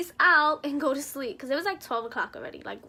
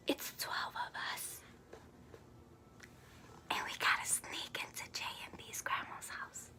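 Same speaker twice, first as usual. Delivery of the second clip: secretive whisper; 14 dB quieter than usual. Real, not acted. Describing sneaking into the grandma's house, whispered.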